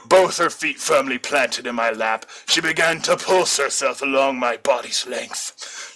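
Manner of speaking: usual speaking voice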